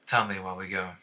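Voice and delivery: soft, discreet prompt; quiet confidence. Acted. Okoria escorting the accused away, quietly confiding. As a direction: keep it hushed and discreet.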